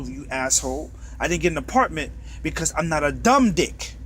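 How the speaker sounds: ordinary talk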